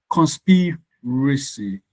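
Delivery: con-SPI-racy, slow, with SPI stretched; stress on second syllable. Shown as a demonstration. Teacher giving the answer conspiracy, stressed on SPI. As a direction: break conspiracy up slowly, leaning on SPI. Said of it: "the sp is what is to be emphasized".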